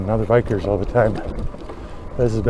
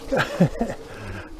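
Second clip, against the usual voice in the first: relieved laugh; 4 semitones higher, 6 dB quieter than usual. Real, not acted. After slipping in mud.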